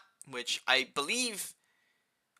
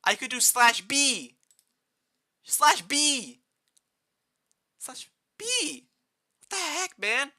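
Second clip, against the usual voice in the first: high, slow, incredulous repeating; outraged high squeak; high-pitched rage; 11 semitones higher, 3 dB louder, slower than usual. Real, not acted. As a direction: whine it higher each time. Keeps raging about slash B.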